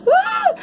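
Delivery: very high short squeal of joy; delighted squeal. Real, not acted. Reader thrilled by her favorite cards, high squeal. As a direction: burst out with a happy squeak.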